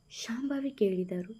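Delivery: ordinary delivery, nothing marked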